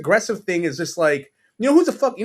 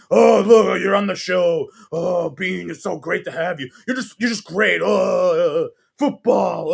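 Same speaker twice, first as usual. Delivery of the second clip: loud, over-the-top mock gush to a guest; a sarcastic impression of sucking up, with bursts of shouting; gushing fake flattery; 2 semitones higher, 5 dB louder, slower than usual. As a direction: ham up a fawning talk-show host. Mocking a host's gushing on-air praise.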